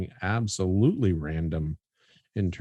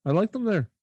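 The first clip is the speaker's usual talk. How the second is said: mock South African accent; 11 semitones higher than usual. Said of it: "It was more South African".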